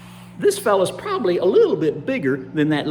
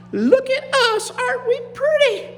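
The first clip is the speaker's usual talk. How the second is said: very high, loud preening voice; admiring gators; 5 dB louder than usual. Acted. Gators admiring their shiny new skin, high delighted preening. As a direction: squeal it with proud delight. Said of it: "they said".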